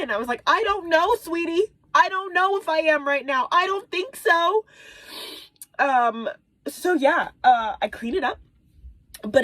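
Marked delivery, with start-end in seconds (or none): 0.4-4.6 high-pitched, half-wailed distress; retold near-tears wail to a child; mock crying. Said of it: "I'm crying because I'm disgusted".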